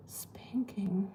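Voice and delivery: breathy secret whisper of the word; hushed, voiceless whisper. Real, not acted. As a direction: whisper it as if it's naughty.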